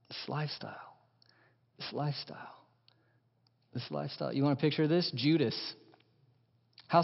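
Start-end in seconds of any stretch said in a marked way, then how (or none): 0.1-4.3 whispered, much softer repeats of this lifestyle; this lifestyle dissolving into a whisper; hushed echo. Acted. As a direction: breathe it away like a fading echo. Echoing, fading repetition.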